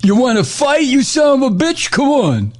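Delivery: high, far mock brawler taunting; imitating the president itching for a fight; tough guy challenge. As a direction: dare him to fight, hammed up. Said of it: "that look on his face that to me says".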